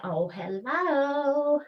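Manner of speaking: voicing her thighs, high and slow; a squeaky drawn-out greeting; high squeaky hello. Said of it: "my thighs just went".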